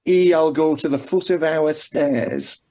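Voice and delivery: slow, broad Macclesfield dialect saying; Macclesfield accent. Shown as a demonstration. Quiz contestant showing off a favourite local saying, broad Macclesfield accent. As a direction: draw it out in broad northern Macclesfield speech. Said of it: "there aren't that many places that say".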